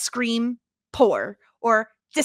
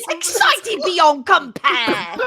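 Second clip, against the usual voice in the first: put-on slow, high, louder stage-accent drawl, stretching each word; Moira impression again; 7 semitones higher, 5 dB louder, much slower than usual. Host repeats Moira's line in a slowed, grander theatrical accent. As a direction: savour the phrase like Moira. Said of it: "he said that he was".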